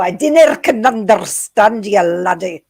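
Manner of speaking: loud, high-pitched hammed-up Scottish; over-the-top Scottish brogue, shouted; mock Scottish accent. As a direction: bellow it like a cartoon Scot. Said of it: "That accent?".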